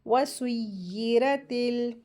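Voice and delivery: वसुय्यिरतिल with a held double y; doubled ya. Shown as a demonstration. Teacher models shadda, held yy in वसुय्यिरतिल. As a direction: lean slowly into the yy of वसुय्यिरतिल. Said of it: "या को सीन में मिलाकर".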